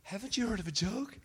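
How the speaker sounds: high, soft voice rising into a question; mock-innocent high-pitched plea; real high voice. Shown as a demonstration. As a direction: throw up your hands and squeak it quietly. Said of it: "get your voice real high and say".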